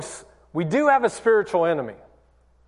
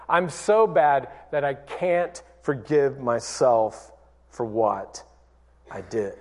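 ashamed self-condemnation; 5 semitones lower than usual. Retold or imitated. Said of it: "Shame, on the other hand, says".

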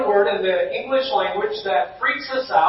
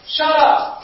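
joking retort; 6 semitones higher, 4 dB louder than usual. Retold or imitated. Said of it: "I was like".

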